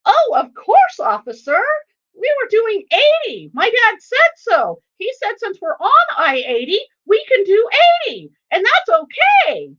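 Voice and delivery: put-on high, loud little-boy voice; outspoken young son. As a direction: pipe up proudly like an unfiltered six-year-old. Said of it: "it's our son that speaks up".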